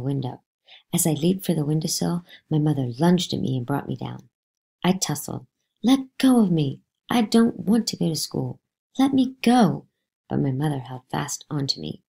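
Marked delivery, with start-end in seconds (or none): 5.8-9.9 put-on loud, higher, desperate child cries; struggling protest. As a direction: fight free while shouting. Boy struggling against his mother.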